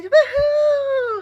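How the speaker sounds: high, loud whoop; excited cheer. Real, not acted. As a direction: cheer out with joy. Celebratory cheer.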